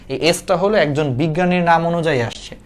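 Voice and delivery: ordinary delivery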